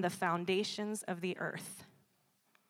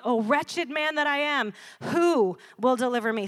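louder, a touch higher cry of despair; anguished Paul; 4 semitones higher, 8 dB louder than usual. Retold or imitated. Preacher voicing Paul's cry, wretched and loud. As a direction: plead for a deliverer. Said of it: "He says".

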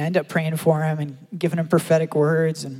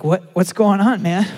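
louder, higher, slowed astonished question; surprised; 4 semitones higher, 8 dB louder than usual. Retold or imitated. Preacher startled by the weeping man now laughing, surprised loud question. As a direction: ask it amazed at the sudden laughter.

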